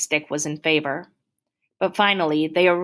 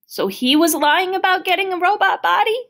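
very high, loud, incredulous question; upset disbelief; 13 semitones higher, 5 dB louder than usual. Acted. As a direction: blurt it out, pitched way up. Boy reacts in disbelief to doctor.

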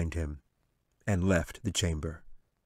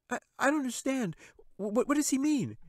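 put-on high, fast, stammering girl; frightened girl. Katie asking the angel, high and stammering. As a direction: stumble over the words in fear. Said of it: "she asked".

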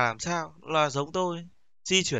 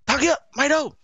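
high, loud imitated headmaster calling out; imitating the headmaster who wants to know where the boy is; mock angry principal; 12 semitones higher, 4 dB louder than usual. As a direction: play the irate headmaster hunting the kid. Principal barking at truant.

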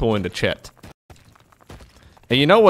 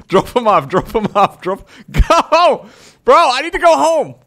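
louder and higher, laughing urgency; frantic yelling; 9 semitones higher, 6 dB louder than usual. Real, not acted. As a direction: urge it on, desperate. Panicked rushing in game.

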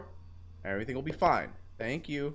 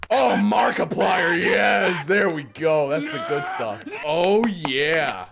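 mock ecstasy; 2 semitones higher, 7 dB louder, much slower than usual. Ecstatic at the screen.

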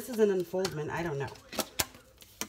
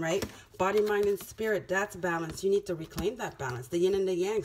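usual speaking voice

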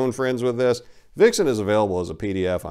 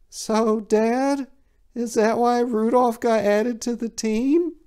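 put-on much higher curious boy; child voice; 14 semitones higher, slower than usual. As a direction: ask dad like a small kid.

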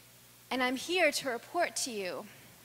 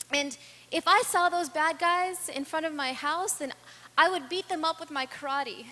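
put-on higher, louder kid bravado; boasting little boy; 6 semitones higher, 8 dB louder than usual. Samir boasting to the speaker about beating bad guys, higher louder child's bravado. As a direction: puff up like a small boy showing off karate.

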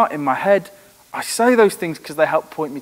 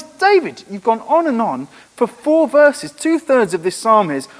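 much higher, louder incredulous outburst; exasperated exclaim; 7 semitones higher, 4 dB louder than usual. Real, not acted. Preacher marvelling at David's sudden switch, exasperated. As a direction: throw up your hands at David.

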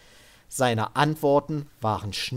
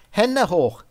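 sharp raised command; gunpoint command; 8 semitones higher, 7 dB louder than usual. Acted. Sid ordering Devin to raise his hands, loud and commanding. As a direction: bark it down the rifle barrel.

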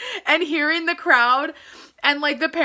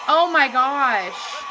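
normal talking voice

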